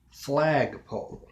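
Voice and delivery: Flagpole stretched out deliberately; slow, weighed "Flagpole"; slow word. Shown as a demonstration. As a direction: draw out FLAG, then let pole fall. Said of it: "slowly and think about the word".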